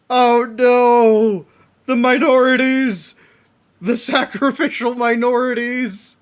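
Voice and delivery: high, loud hammed-up panic; mock alarm. Reacting to the villains' victims, hammed-up loud panic. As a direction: wail like a melodramatic bystander.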